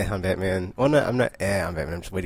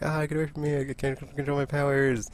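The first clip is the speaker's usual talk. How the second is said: mock panic; 8 semitones higher than usual. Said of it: "and she's like".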